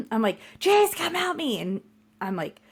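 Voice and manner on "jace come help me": high, called across the house; mock call to the living room. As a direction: call him over to help. Calling husband from other room.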